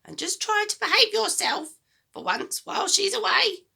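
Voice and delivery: put-on high, loud, bossy old woman; a mean grandma giving orders, high and loud; grumpy grandma voice. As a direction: nag the boy like a sour old granny.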